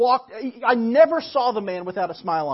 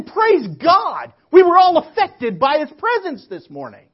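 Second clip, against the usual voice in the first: higher and louder, glad praise; joyful praise; 7 semitones higher, 10 dB louder than usual. Retold or imitated. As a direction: shout it out gladly to God. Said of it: "and say".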